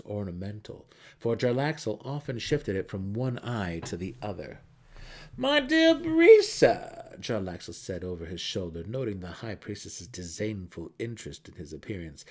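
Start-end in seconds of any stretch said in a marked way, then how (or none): 5.3-7.2 much higher, louder, slow theatrical greeting; mercenary's drawn-out greeting. A narrator's reading. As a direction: stretch it out like a showman teasing her. Rogue mercenary voiced mockingly.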